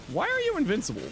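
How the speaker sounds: high, surprised question; baffled at the invincible enemy. Real, not acted. Surprised at game.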